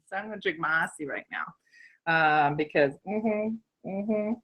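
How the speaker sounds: usual speaking voice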